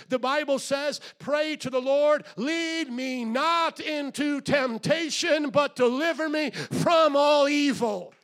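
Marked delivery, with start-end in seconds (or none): 1.2-8.1 high, loud, slow shouted prayer; preacher shouting a prayer of courage. Acted. Preaching the prayer at full force.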